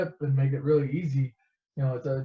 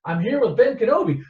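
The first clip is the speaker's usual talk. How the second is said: raised, louder proud announcement; eager announcement; 6 semitones higher, 5 dB louder than usual. Retold or imitated. Luke telling Leia who came along, eager. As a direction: announce the name like big news.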